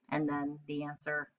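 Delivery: plain reading voice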